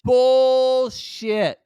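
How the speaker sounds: high, loud scornful cry; scoffing outburst at the screen; shouted bullshit. Retold or imitated. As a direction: yell it at the TV in disbelief. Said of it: "And I went".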